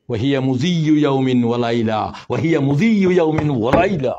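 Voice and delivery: very slow, drawn-out intoning; slowly reciting the hadith line; slow Arabic recital. Acted. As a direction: stretch each Arabic word.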